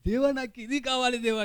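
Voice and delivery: high mock-weeping plea to God; imitated tearful prayer. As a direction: act out a child begging God in tears. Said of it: "నేను ఏడుస్తూనే".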